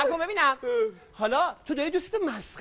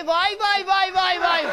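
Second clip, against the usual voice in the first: mock wailing; 5 semitones higher, 6 dB louder than usual. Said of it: "همه میگه".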